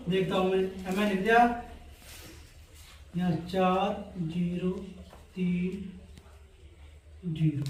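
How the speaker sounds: low, soft voice, working it through; talking to oneself. Real, not acted. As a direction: say it quietly while writing the digits. Talking while writing digits on board. (धीमी, दबी आवाज़ में खुद से बोलते हुए)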